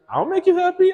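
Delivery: a sweet, sing-song offer; playful wife. Retold or imitated. Wife promising to cheer him up, high playful voice. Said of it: "she was like".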